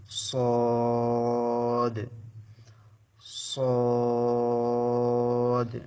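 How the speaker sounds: সদ stretched long, loud and heavy. Shown as a demonstration. Teacher stressing the thick sound of সদ for students. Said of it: "এই অক্ষরটা মোটা হবে".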